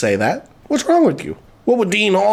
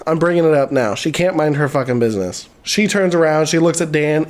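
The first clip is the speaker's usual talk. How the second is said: normal talking voice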